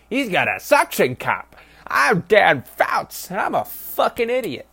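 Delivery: high, slow, drawn-out mock announcer voice; sneering parody of a play-by-play man. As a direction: ham up a dopey TV announcer. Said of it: "the announcer called it".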